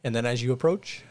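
ordinary delivery

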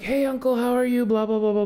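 high, singsong mock hello; cheery greeting. Greeting the difficult uncle on arrival, put-on cheer. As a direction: put on a high-pitched sociable greeting, rattling it off.

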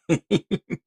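loud high cackle; wild rambling cackles. Real, not acted. Laugh after yell.

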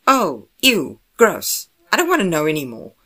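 disgusted. Acted. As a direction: recoil in brotherly disgust. Brother disgusted by what he hears.

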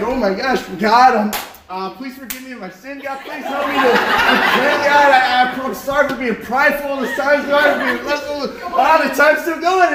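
frantic, high, hammed-up begging; acted-out last-second panic; mock panic. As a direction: babble a desperate prayer, played for laughs. Acts out frantic last-second repentance.

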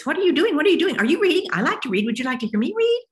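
high, loud, eager goose voice; chatty goose. Retold or imitated. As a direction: chirp it out like a pushy talkative goose. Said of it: "goose comes up and says".

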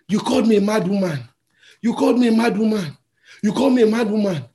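woman raging at the conductor; angry woman. Retold or imitated. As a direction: hurl the accusation over and over. Woman furious at insult.

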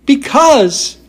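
high, delicate princess voice; a dainty china princess; cartoon princess. A narrator's reading. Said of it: "frightened little voice".

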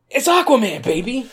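high, loud hype shout; excited announcement. Pretend. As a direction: announce it like a trailer. Hyping the movie title.

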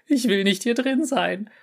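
high frightened wail; high panicky protest; panicked. Acted. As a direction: beg to get out. Panicked cat in human body.

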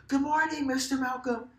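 high, loud imitation of excited kids; kids yelling greeting. Kids greeting their teacher in the morning, high mock yell. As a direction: belt it out like a child arriving in class. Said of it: "the kids come in and they yell".

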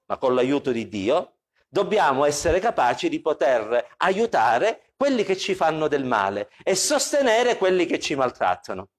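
ordinary talk

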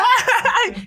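high-pitched burst of laughter. Real, not acted. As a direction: break into a high helpless laugh. Laughing at his own story.

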